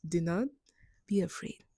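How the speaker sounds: hushed whisper to herself; whispering to herself that there is nothing to fear; whispered self-calming. Retold or imitated. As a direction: breathe the words out to steady your own nerves. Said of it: "I was like".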